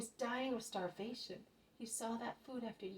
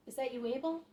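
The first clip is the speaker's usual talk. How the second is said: calling out to a friend; 5 semitones higher, 7 dB louder than usual. Acted. Calling out into the dark.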